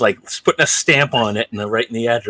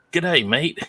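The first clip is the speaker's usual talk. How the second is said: put-on higher, Australian-sounding go at an English accent; attempted English accent; 7 semitones higher than usual. Father imitating his son, muddled English accent. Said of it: "do your English accent".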